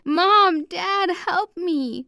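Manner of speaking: high, drawn-out cry for help; screamed. A narrator's reading. Kidnapped girl calling to her parents, screamed. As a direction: cry out high and loud, then stretch it slowly. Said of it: "I screamed".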